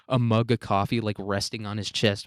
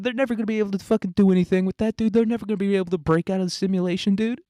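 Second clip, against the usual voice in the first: high, whiny begging, getting more frantic; hammed-up desperate panic; panicked pleading; 9 semitones higher than usual. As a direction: beg a coworker to cover for you. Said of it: "he's, like, panicking on his laptop".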